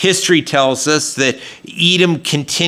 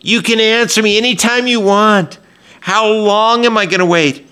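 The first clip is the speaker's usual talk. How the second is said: high, louder urgent plea; a worshipper's demanding appeal, pitched up; impatient pleading; 8 semitones higher, 3 dB louder than usual. Retold or imitated. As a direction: press God for an answer now. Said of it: "It's like they realize".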